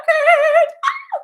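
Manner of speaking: high, loud squeal of delight at the record; excited squeal. Real, not acted. Streamer squealing at her new live record. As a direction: let out a thrilled shriek.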